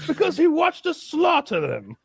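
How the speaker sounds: a hint of a grave announcer; mock exhibit announcer.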